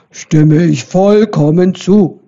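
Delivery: gruff boar agreeing; grunting boar. A narrator's reading. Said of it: "grunzte Wildschwein Eberhard".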